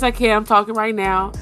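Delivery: ordinary talk, nothing marked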